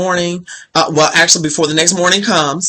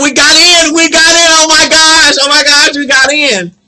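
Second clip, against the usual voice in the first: high, loud squealing replay of the good news; retold squeal of joy; 11 semitones higher, 7 dB louder than usual. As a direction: shriek it like the letter just came. Sophia bursts in with news.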